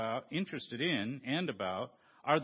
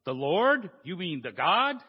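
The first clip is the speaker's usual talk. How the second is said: astonished; 2 semitones higher, 7 dB louder than usual. Acted. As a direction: gasp it out in disbelief. Jack astonished at God's voice.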